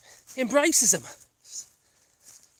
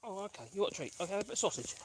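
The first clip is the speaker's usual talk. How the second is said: hushed; 14 dB quieter, much faster than usual. Real, not acted. Quiet when people pass.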